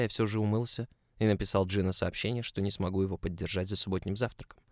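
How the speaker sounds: ordinary delivery, nothing marked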